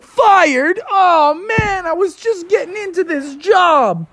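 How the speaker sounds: fired pizza man whining to his boss; dismayed whine. Acted. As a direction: wail at the unfairness. Bubby upset at being fired.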